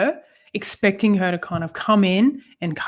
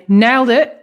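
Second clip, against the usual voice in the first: loud, punchy mock celebration; cocky, pleased-with-yourself quote; triumphant boast; 4 semitones higher, 6 dB louder than usual. Mocking a one-visit gym-goer, triumphant boast.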